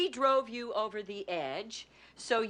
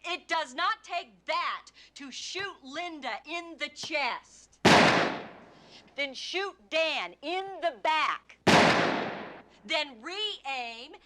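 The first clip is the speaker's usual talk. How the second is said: raised pitch, deliberate pauses between blows; relentless accusation; 5 semitones higher, 7 dB louder than usual. Acted. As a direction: lay out each act like a blow. Lawyer reenacts each shot accusingly.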